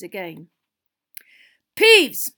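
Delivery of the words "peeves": loud, high shout of a name; angry yell. Acted. Teacher catching the poltergeist at it, angry yell. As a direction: yell it like a furious summons. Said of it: "yelled an angry voice".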